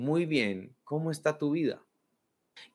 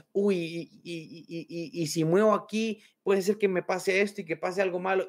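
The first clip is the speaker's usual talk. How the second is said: anxious spiral; 5 semitones higher than usual. Retold or imitated.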